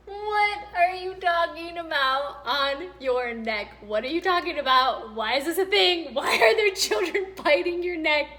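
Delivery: loud, high, laughing disbelief; incredulous shout. Retold or imitated. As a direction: yell at the absurdity. Hears the kid bit his neck.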